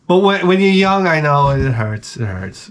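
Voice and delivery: ordinary talk, nothing marked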